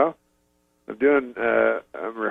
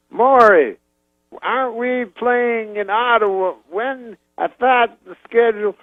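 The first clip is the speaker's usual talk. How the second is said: high, slow halting impression; Bill Cosby; 10 semitones higher, 4 dB louder, slower than usual. Cosby querying his manager about Ottawa, high halting impression. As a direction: call out, then ask in drawn-out fragments. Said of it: "he goes".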